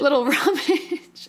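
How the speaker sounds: repeats the phrase high and slow through a laugh; amused echo of a silly phrase. Real, not acted. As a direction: echo it while cracking up. Repeating co-host's funny phrase.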